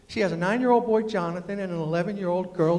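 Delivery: ordinary talk, nothing marked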